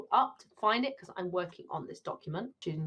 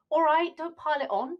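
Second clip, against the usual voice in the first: irritated retort; 8 semitones higher, 5 dB louder than usual. Acted. Character snaps back.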